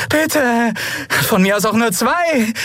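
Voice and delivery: high, desperate plea; whining high-pitched bargaining; begging. A narrator's reading. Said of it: "bettelte sie den Kaiser an".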